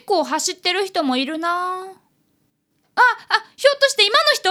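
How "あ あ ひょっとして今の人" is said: startled realization. Acted. Boy startled spotting runner.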